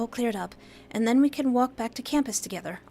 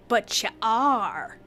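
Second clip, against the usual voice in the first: slow, heavy accusation; slow ominous; 4 dB louder, much slower than usual. Acted. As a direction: drop it like a verdict.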